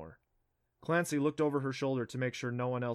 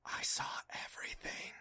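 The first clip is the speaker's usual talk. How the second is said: unvoiced secretive whisper; 9 dB quieter than usual. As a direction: breathe it close to his ear. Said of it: "she leaned toward him to whisper".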